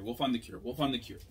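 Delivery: usual speaking voice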